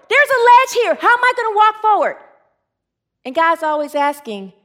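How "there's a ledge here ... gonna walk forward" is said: high, loud, incredulous outburst; acted panicked protest. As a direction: protest in alarm at the command. Acts fearful refusal to step forward.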